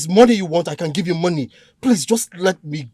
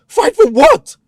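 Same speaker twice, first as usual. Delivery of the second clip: much higher, louder disbelieving cry; shocked protest; 6 dB louder, slower than usual. Acted. Captive protesting accusation.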